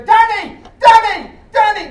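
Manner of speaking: high, loud calling out of a name; piercing call. Retold or imitated. Woman in the street calling out to the comic, high and loud. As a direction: yell the name across a busy street. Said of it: "I can't do it as loud or as piercing".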